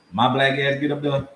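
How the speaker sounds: ordinary talk, nothing marked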